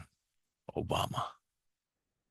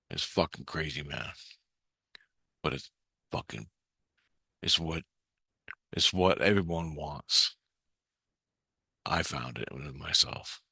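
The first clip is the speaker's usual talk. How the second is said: hushed; 4 semitones lower, 8 dB quieter than usual. Real, not acted. Hushed confession about the Omni.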